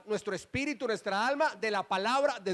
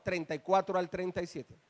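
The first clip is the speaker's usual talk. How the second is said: ordinary delivery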